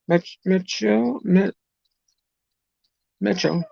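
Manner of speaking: sounding out "Mecho" haltingly with a CH; unsure, broken attempts at "Mecho" as METCH-oh; tentative pronunciation. Real, not acted. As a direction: feel your way through "Mecho", restarting mid-word. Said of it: "Tell me if I'm saying that right".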